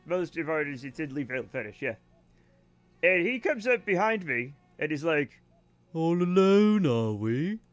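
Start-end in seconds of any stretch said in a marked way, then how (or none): 5.9-7.6 a lightly put-on lecherous drawl, first words louder; imitating a creepy teacher sidling up. Teller imitates creepy teacher cornering her late at night, lightly lecherous. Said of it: "'Cause that's how he fucking talked".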